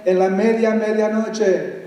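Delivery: slow, rhythmic Spanish poem reading; dramatic Spanish verse reading; poem recital. Acted. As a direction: chant the verses like an incantation. Reads a Spanish poem aloud.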